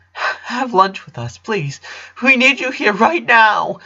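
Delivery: high, tearful pleading; in tears. A narrator's reading. The father pleads with Lincoln to stay, in tears. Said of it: "also in tears".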